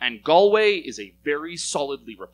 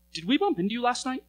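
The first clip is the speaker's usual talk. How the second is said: much higher-pitched tentative question; retold puzzled question; 11 semitones higher than usual. Hungover couple asking the housemate at breakfast, in a high, puzzled voice.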